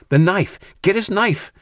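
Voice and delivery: high, loud urgent scream. A narrator's reading. Jack screaming at Katie to grab the knife. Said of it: "screamed Jack".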